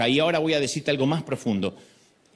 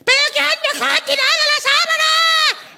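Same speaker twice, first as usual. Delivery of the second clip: much higher, louder mock shout at the kids; mother yelling; 7 dB louder than usual. Mother yelling at kids about the sheet, shrill hammed-up shout. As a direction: screech it like a fed-up mom. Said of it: "gritando otra vez".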